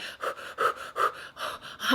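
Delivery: long unvoiced sigh out; a sigh as the feelings rush in; heavy sigh. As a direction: let out a weary breath. Overwhelmed mid-story.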